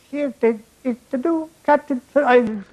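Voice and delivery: the "new captain for Ireland" in a lilting Irish voice; Irish accent. Retold or imitated. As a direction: announce the "new captain for Ireland" like a jolly Irish team-mate. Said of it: "repeated in Irish voice".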